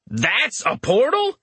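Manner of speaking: high, slow astonished question; asked loudly. A narrator's reading. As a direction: ask it loudly in disbelief. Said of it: "Richter asked loudly".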